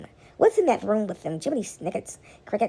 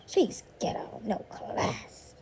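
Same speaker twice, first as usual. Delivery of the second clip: whispered catty gossip; mock whispered put-down; snooty whisper; 9 dB quieter, much slower than usual. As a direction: hiss it behind a hand like a snob. Snobs gossiping behind your back.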